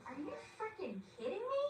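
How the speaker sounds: high exasperated retort, acted out; mock frustration, pitch swooping high; frustrated. Daughter snaps at her mom to have an open mind, frustrated. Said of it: "gets frustrated".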